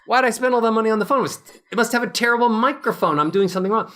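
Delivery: rapid frustrated complaint. Retold or imitated. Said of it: "And then you were like".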